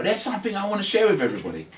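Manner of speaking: loud overexcited announcement; announcing loudly. Shown as a demonstration. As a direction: blurt it out like a big announcement. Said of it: "you wanna go".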